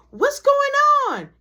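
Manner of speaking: high, loud imitation of a startled housemate; shouted question. Kayla comes out to the commotion, shouting.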